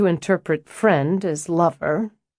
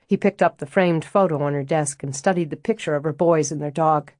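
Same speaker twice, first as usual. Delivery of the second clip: usual speaking voice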